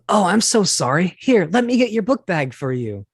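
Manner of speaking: put-on high, sweet anime boy voice; bright falsetto anime schoolboy apology; cute anime schoolboy. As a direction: play the shy, eager schoolboy offering help. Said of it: "auditioning for the role of a cute little anime schoolboy".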